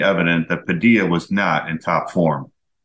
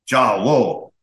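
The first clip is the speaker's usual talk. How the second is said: mildly boomed mock-German reply; booming salute; 4 semitones higher than usual. A narrator's reading. Schwarzenegger salutes the head judge from the front row, mildly booming. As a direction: boom it out as a narrator would, without shouting. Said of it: "and boomed".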